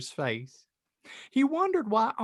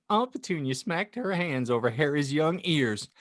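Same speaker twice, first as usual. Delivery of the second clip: ordinary talk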